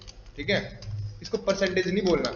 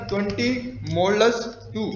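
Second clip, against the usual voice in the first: modulus टू read out very slowly for writing down; slow dictation; slower than usual. Shown as a demonstration. Teacher dictating the problem to students, very slow. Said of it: "मैं लिखा".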